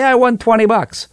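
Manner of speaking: high gleeful quote of a lottery winner; mock proud winner. Host mimics a lottery player bragging, high and gleeful. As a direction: crow it like a smug winner. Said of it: "and they say".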